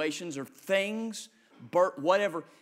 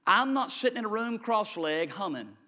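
ordinary delivery

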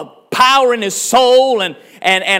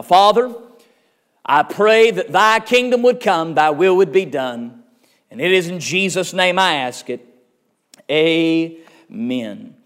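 prayer; 5 semitones lower than usual. Acted. Preacher praying to God before the sermon, hushed and reverent.

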